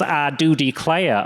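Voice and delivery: slow Southern drawl on "I do declare"; mock Southern gentleman accent. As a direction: play a genteel Southern gentleman. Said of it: "gentleman that says".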